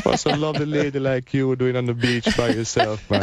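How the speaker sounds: playful, hammed-up Jamaican accent. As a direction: flirt in a thick Jamaican accent. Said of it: "put on my strongest Jamaican accent".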